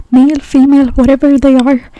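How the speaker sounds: loud, emphatic venting; exasperated rant. Real, not acted. Speaker fed up with difficult people, loud exasperation. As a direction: raise your voice in exasperation. Ranting about meeting more Karens.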